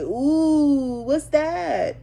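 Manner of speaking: put-on high, loud, wide-eyed baby voice; imitating baby wonder. Mom imitating her baby outside, high and amazed. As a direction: play the little one marvelling at everything. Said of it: "he's like".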